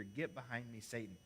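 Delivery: cold and low, not shouted; Jesus turning sternly on Peter; low stern rebuke. Acted. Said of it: "Powerful rebuke".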